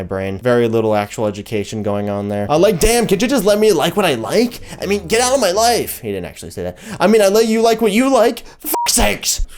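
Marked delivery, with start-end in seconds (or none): 2.5-6.0 high, loud mock outburst; hammed-up indignant yelling; exasperated rant. Acted rant at confused askers. 7.0-9.5 high, loud mock fury; hammed-up swearing outburst; exasperated rant. Acted rant continues.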